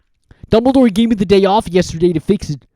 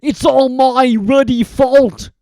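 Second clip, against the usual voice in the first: slow, loud, higher sobbing giant; 9 semitones higher, 9 dB louder, slower than usual. Acted. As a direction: wail the blame. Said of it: "he sobbed".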